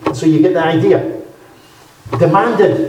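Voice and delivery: ordinary talk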